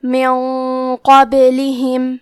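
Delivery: "من قبلہم" with the nun hidden in a heavy nasal ghunna before qaf. Shown as a demonstration. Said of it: "تو ہمیں یہ غنہ کو بھاری آواز میں ادا کرنا ہے". ("من قبلہم" میں نون کو چھپا کر بھاری غنہ کے ساتھ)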